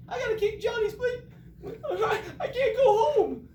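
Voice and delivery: loud high-pitched comic wail; hammed-up sobbing child; crying boy. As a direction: bawl it out like a tearful kid. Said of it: "Morris has been crying".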